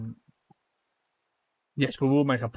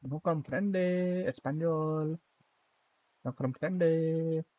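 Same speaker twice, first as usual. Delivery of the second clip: slow, exaggerated Spanish accent, a little higher; mock Spanish accent; 4 semitones higher, 4 dB quieter, much slower than usual. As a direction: drawl it out like a caricatured Spaniard. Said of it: "my favorite accent".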